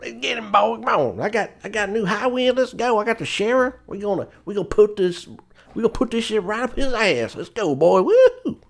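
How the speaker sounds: high, hollering Southern caricature; Sid Highwind. Acted. Mocking the pilot rallying boys after Sephiroth, hammed-up Southern accent. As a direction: whoop it up in an over-the-top Southern accent. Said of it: "they still don't sound like Sid Highwind".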